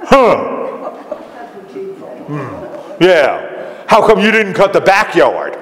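a grudging, unimpressed grunt, then a complaint; grumpy neighbour. Acted. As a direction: grumble like a neighbour who finds fault with the favour. Acted grumpy ungrateful neighbour.

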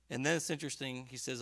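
normal talking voice

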